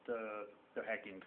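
usual speaking voice

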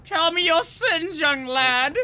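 high, slow, solemn mock priest voice. To his co-op partner at the confessional booth, a high slow mock priest. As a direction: ham up a pompous confessor, high and drawn out.

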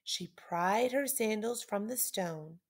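ordinary delivery

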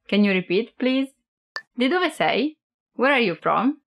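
usual speaking voice

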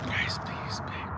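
breathy whisper. Real, not acted. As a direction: whisper it aside. Whispered aside.

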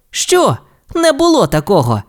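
high, indignant denial; denying. Acted. Race car denies the story, indignant. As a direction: deny it flatly. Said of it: "заперечив Маквін".

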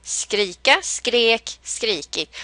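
"Skrika" rising, "skrek" level, "skrikit" falling; verb melody. Shown as a demonstration. Teacher pointing out the melody of the forms. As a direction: bring out the rise and fall in "Skrika, skrek, skrikit". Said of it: "du hör melodin".

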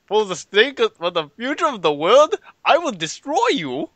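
grand villain with a mock Scottish accent; over-the-top Scottish hero voice; Scottish Silver. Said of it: "And he would be like".

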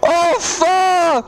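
real shouts of joy; overjoyed shouting. Real, not acted. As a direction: shout it out, the wait is over. Shiny found after long hunt.

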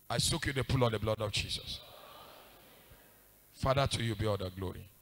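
low, soft prayer murmured over someone; quiet prayer. Real, not acted. Preacher soaking them in the blood of Jesus, praying quietly. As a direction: pray it softly, low in the voice.

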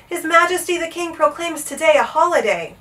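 high announcing call. A narrator's reading. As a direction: proclaim it high and a little loud. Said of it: "he called".

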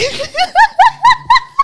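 loud, very high burst of laughter; high laughing cackle. Real, not acted. Host amused by viewers telling her to leave, high cackle. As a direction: crack up in a piercing, pulsing laugh.

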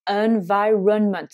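environment drawn out slowly, each schwa clear; slow English pronunciation. Shown as a demonstration. Teacher slowing down environment for learners. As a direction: spell out environment syllable by syllable. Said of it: "on le retrouve dans".

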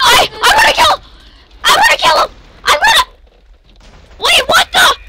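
high, loud gamer excitement; worked-up, high-pitched yell; frantic yelling. Real, not acted. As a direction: shout it in a rising frenzy. Excited yelling during a game kill.

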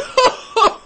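a gentleman's hearty laugh; a man in a top hat laughing at a hungry boy; amused laugh. A narrator's reading. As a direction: laugh out loud at the child.

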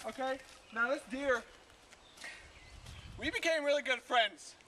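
usual speaking voice

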